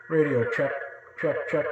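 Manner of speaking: half echo, slightly tinny; radio check with the echo at half; toy echo mic. Real, not acted. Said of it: "That's halfway".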